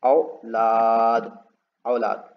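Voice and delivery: औलाद very slow, the औ held long; drawn-out word. Shown as a demonstration. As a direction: stretch the औ in औलाद. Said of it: "A यू औ". (औ खींचकर औलाद)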